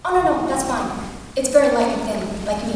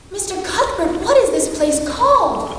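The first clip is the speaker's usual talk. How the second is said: excited calling; 7 semitones higher, 4 dB louder than usual. Acted. Excited girl calls to driver.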